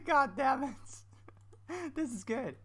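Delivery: high, loud laughing curse; cracking up mid-sentence. Real, not acted. Laughing hard at his build.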